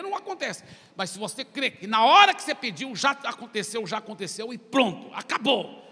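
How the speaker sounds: ordinary delivery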